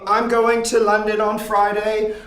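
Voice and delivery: put-on American doing a light British accent. Host on his British-themed stream, lightly put-on British accent. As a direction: keep up a mild British accent all night. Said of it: "I will not stop doing a British accent".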